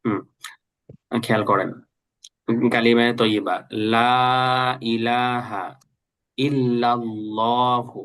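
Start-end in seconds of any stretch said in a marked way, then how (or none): 2.7-8.0 slow, drawn-out Arabic recitation; careful recitation. Shown as a demonstration. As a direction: recite it clearly for students to copy. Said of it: "আমি একটু ভালো করে পড়তেছি". (ধীরে, স্পষ্ট করে কালিমা তিলাওয়াত)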